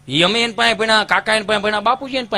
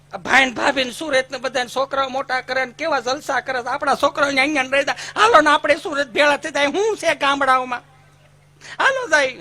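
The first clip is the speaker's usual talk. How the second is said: higher, pushy wheedling to move to the city; eager coaxing; 6 semitones higher than usual. Retold or imitated. Wife coaxing her husband to move to Surat, eager and higher. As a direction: coax him eagerly toward Surat.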